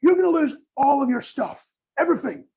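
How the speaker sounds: hammed-up panic about the fire; panicking fireman. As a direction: overdramatic doom-saying fireman. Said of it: "If the fireman's like".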